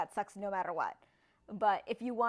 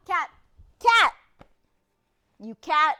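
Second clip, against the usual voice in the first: shout; 5 semitones higher, 13 dB louder than usual. Real, not acted. Shouting at a cat.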